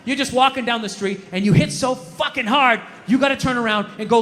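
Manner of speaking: ordinary talk, nothing marked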